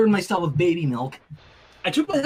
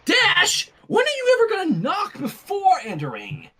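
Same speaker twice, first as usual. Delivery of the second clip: exasperated scold; 11 semitones higher than usual. Acted. Sunset scolding Rainbow for not knocking, high and annoyed. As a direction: snap at the friend who barged in.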